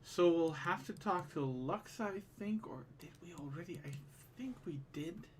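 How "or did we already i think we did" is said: whispering. Real, not acted. Muttering to himself, unsure.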